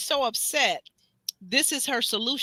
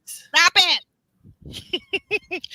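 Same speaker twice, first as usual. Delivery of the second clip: playful stop it; 7 semitones higher, 7 dB louder, much slower than usual. Real, not acted. Teasing friend, playful.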